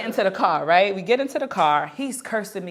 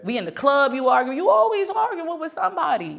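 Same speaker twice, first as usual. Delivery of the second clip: high-pitched retold complaint; exasperated nagging; 8 semitones higher than usual. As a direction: pile up the accusations, rising higher. Retold scolding of boyfriend.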